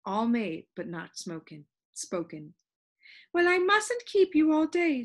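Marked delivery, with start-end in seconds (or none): 3.3-5.0 high, brisk dismissal; impatient high-pitched Soundkeeper. A narrator's reading. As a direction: hurry the visitor along in a high voice. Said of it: "she said impatiently".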